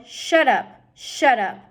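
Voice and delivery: loud, sharp hush; snapping. Acted. Roscuro stopping Mig's answer, loud and sharp. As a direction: cut her off. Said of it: "Roscuro silencing Mig".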